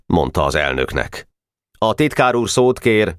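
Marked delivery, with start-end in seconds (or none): 1.8-3.2 serious formal tone. Acted. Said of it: "mondta komolyan Weiss".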